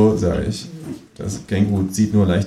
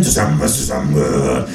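much lower rallying cry; gruff patriot; 11 semitones lower than usual. Acted. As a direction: call it out low and proud. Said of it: "ruft Freundlich".

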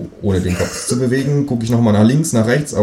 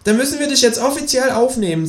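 much higher, cartoonish kangaroo voice. Kangaroo asking the narrator, in a much higher voice. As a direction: pitch it way up like a cheeky kangaroo.

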